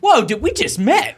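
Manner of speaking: very high, drawn-out protest; mock taken aback. Joking as reporter balking at a favour, mock shock. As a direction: balk in an exaggerated high voice. Jokes as the reluctant phone owner.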